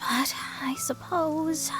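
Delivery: slow, unvoiced whisper to herself; whispered aside. Astrologer grudgingly admits she could teach outsider, whispering. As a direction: mutter it reluctantly under her breath. Reluctant aside before agreeing.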